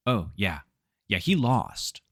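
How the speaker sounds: plain reading voice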